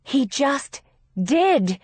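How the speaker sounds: heavy, drawn-out retort; despairing. A narrator's reading. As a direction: drag it out in exasperated despair. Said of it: "in a tone of despair".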